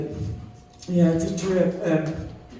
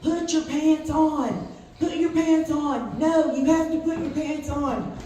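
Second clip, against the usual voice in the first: high, slow mock yell at a stubborn patient; retold scream; 9 semitones higher, slower than usual. Sitter yelling at the old man next door, hammed-up high shout. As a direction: bellow it like a fed-up sitter. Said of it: "started screaming".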